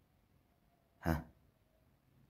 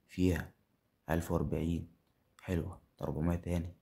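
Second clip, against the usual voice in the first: usual speaking voice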